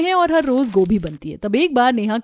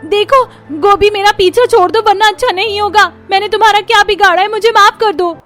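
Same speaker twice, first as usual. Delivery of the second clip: high, loud frantic yell; shouting; 9 semitones higher, 7 dB louder than usual. A narrator's reading. Neha shouting at her neighbour she thinks is cabbage, high loud panic. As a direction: scream at the cabbage to leave her alone. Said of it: "जोर से चिल्लाती है".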